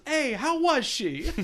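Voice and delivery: loud, high, slow mock heckle; New York wiseguy. Imitating a connected friend going up to Barney, loud wiseguy ribbing. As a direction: holler it like a leering mob buddy. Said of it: "a bunch of his old, like, New York, like, kinda connected friends".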